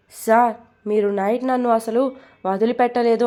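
plain reading voice

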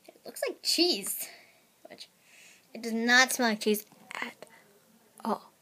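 normal talking voice

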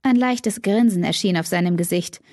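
usual speaking voice

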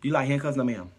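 ordinary talk

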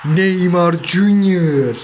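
very slow, drawn-out commentator call with Junior held long; stretched goal call. Pretend. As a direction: stretch each syllable like a stadium announcer. (yavaş, uzatılmış spiker gol anonsu)

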